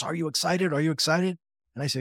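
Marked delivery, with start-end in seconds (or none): 0.0-0.7 eager question, pitched higher; bubbling over for him; wife asking her husband, higher and eager; excited wife. Retold or imitated. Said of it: "and she goes". 0.7-1.4 repeated eagerly, higher and rising; pressing him with delight; wife insisting, high and excited. Retold or imitated. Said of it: "and she goes".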